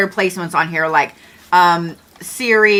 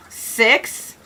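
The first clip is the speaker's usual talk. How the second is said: ordinary talk, nothing marked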